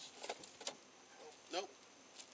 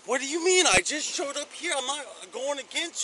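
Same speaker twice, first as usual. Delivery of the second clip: upset shouting; 3 semitones higher, 8 dB louder than usual. Real, not acted. Protesting during arrest.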